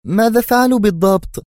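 very high, loud, anxious female voice; tense question. A narrator's reading. She demands to know his punishment, tense and loud. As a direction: demand it in a strained high pitch. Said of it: "سألته في توتر".

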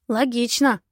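usual speaking voice

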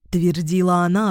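ordinary delivery

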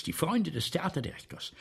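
normal talking voice

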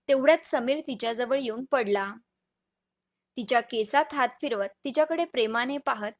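plain reading voice

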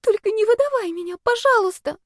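high, squeaky desperate plea; pleading. A narrator's reading. Girl begs not to be given away, high pleading. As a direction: beg in a small, very high voice. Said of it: "взмолилась она".

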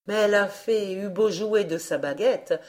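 plain reading voice

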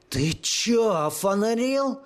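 high, slow, roaring thug voice; furious roar. Acted. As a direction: bellow it in a rage. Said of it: "бешено взревел дрон".

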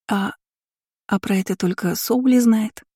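stall, then mumble the excuse; mumbled. A narrator's reading. Lina dodging the mage's question, hesitant mumble. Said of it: "промямлила Лина".

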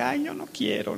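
high, soft whiny complaint; mock whining recruit. Preacher mocking a soft recruit, lightly whiny. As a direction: whimper like a lazy recruit. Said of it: "Y no es nada de que".

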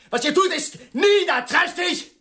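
indignant shout, pitch up, half laughing in disbelief; angry outburst. Acted. Fed up with the pair quarrelling, an indignant shout. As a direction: hurl the accusation at them.

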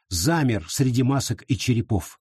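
usual speaking voice

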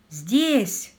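ordinary delivery

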